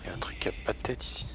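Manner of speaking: hushed whisper in the dark. Real, not acted. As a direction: breathe it out, scared to be heard. Creeping past something in horror game.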